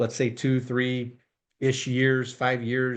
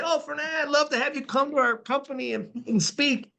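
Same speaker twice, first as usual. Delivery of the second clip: much higher put-on pleading voice; imagined eager client; 11 semitones higher than usual. Host imitates companies asking Fernando to come and speak, high-pitched mock eagerness. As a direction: mimic a would-be client in a high voice. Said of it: "you're gonna say".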